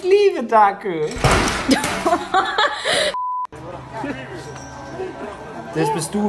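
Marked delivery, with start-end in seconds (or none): 1.1-5.7 long squeaky giggling fit; high-pitched giggle. Real, not acted. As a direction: giggle helplessly. Long high laugh after Dackel. (hohes, langes Kichern)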